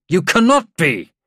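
high, forceful protest; loud disbelief. A narrator's reading. General to major on his position, loud disbelief.

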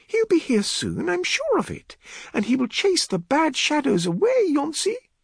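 high-pitched comforting woman's voice; reassuring woman. A narrator's reading. Elodie calming the frightened girl, reassuring.

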